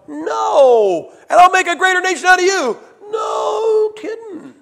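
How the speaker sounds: high, loud wailing protest; Moses pleading. Retold or imitated. Moses begs God to spare Israel, high wailing mock protest. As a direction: cry out as a desperate Moses. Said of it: "Moses going".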